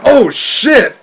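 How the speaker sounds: high, loud shocked outburst; startled yell. Retold or imitated. Fan reliving a wrestler's surprise entrance, startled yell. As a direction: blurt it out as if caught off guard.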